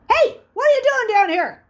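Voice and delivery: loud high shout down the hall. A narrator's reading. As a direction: bellow at a kid caught sneaking. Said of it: "he heard the shout".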